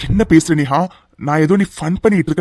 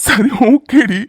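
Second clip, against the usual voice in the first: hammed-up fake crying, a touch louder; mock sobbing; 3 semitones higher, 3 dB louder, much slower than usual. Teasing his bride, he fakes a sob. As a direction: pretend to weep to tease her.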